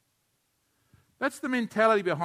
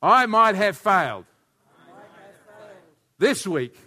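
much louder and slower, punching each phrase; repeated after being told it wasn't meant; louder, like you mean it; 8 semitones higher, 11 dB louder, slower than usual. Real, not acted. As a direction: say it again and really mean it this time. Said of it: "Let's say it again".

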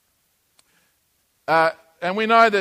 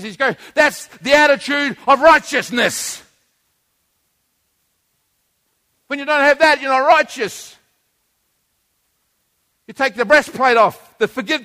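building from raised to shouting, much louder and higher; rising fervent sermon shout; preaching at full force; 8 semitones higher, 8 dB louder than usual. Acted. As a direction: drive it home like a fiery preacher.

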